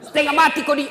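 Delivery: high, loud jab; angry mother. Retold or imitated. A mother scolds her husband again. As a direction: spit it at the husband. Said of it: "Langsung datang mamanya".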